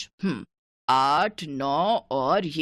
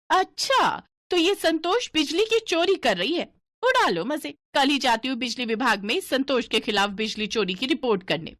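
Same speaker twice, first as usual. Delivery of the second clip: gloating neighbour; 5 semitones higher than usual. Acted. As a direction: crow over the secret you just caught.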